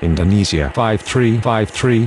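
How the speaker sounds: ordinary delivery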